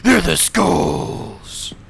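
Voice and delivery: metal growl. Acted.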